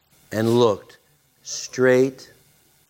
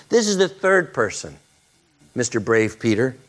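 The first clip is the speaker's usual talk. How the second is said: usual speaking voice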